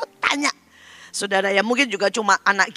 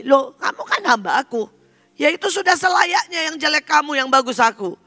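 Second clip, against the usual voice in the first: high, emphatic comeback; God's mock retort; 8 semitones higher than usual. God answers His complaining servant, high retort. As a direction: answer back with raised pitch, as if obvious. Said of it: "Tuhan bilang begini".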